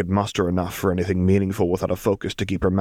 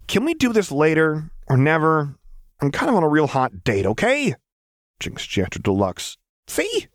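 petulant whine; 8 semitones higher than usual. A narrator's reading. Petulant Jinx.

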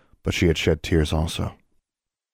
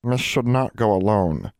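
slow, higher squeaky elf, insisting; insistent house elf; 4 semitones higher than usual. Acted. House elf Nina insisting Alex not go alone. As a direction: plant the words firmly like a stubborn elf. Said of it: "Nina insisted".

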